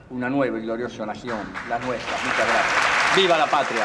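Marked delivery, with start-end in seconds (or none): 3.1-3.8 rousing cry to the crowd; shouting. Real, not acted. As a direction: call it out loud. Closing shout of a speech.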